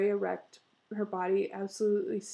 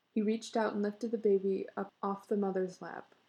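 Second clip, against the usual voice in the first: ordinary delivery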